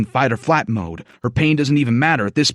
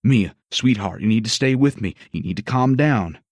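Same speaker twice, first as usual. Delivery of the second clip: firm calming doctor; 8 semitones lower than usual. Acted. Doc calming Mia.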